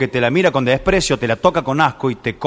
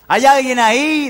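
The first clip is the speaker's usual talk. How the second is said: slow high call into a cave; shouting; 9 semitones higher, slower than usual. Acted. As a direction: call out slowly into the dark. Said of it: "así gritaba el médico".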